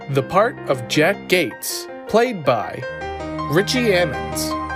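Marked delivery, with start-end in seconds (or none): none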